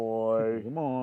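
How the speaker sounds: slow drawn-out Aussie drawl; Australian accent. Retold or imitated. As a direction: stretch it like a bogan. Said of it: "our terrible Australian accents".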